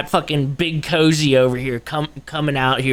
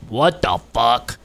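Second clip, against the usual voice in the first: slow disbelief; 4 semitones lower, slower than usual. Acted. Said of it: "People would be like".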